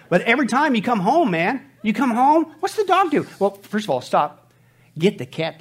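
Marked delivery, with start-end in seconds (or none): 0.1-3.3 rising excitement. Acted. Building to the dog's greeting.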